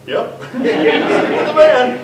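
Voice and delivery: slow line broken by laughter; laughing. Retold or imitated. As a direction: laugh through the words. Laughing at own plan.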